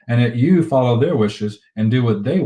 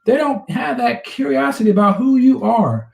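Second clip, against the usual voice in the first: high, emphatic, worked-up delivery; impassioned, pitched well up; fired up; 10 semitones higher, 3 dB louder than usual. Real, not acted. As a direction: drive the point home with rising fervor. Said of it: "He's fired up today".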